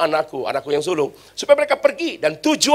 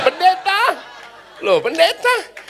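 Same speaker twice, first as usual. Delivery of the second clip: mock surprise; 13 semitones higher, slower than usual. Mock astonished audience.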